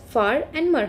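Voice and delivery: ordinary talk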